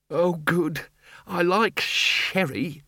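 high, slow, dreamy bursar; dithery bursar. A narrator's reading.

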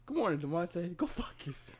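cheery greeting then hushed curse; sweet hello, then drop to a whisper; coworker's deadpan morning insult; imitating coworker. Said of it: "I got a coworker".

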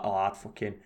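soft grumble under the breath; muttering old man. Retold or imitated. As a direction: mutter it quietly to someone beside you. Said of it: "muttering under his breath".

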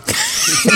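high, breathy burst of laughter. Real, not acted. Laughs at the "versión veinticinco" joke. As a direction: cracks up at the joke.

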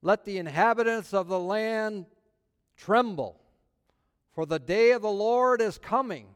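loud, rising scripture proclamation; preaching. Acted. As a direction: thunder it out like a prophet. Proclaims scripture at full force.

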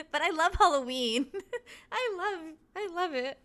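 high, excited, laughing delight; real giddy enthusiasm; gushing enthusiasm. Real, not acted. Gushing about Halloween.

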